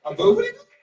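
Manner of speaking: high squeaky mispronounced name; high rising puzzled "boobity boo"; asking his strange name back in a high voice; puzzled high question. Retold or imitated. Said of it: "What was it?".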